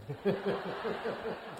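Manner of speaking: quiet laugh that lifts in pitch; rising laugh. Real, not acted. Writer laughing at his mother's sharp verdict, light laugh. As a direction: laugh in amused disbelief.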